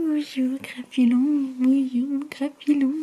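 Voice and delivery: slow, high sing-song pet voice. Real, not acted. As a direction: coo at the dog lovingly. Greeting her dog.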